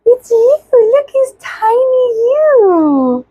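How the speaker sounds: high, slow, delighted cooing. Real, not acted. Cooing at baby.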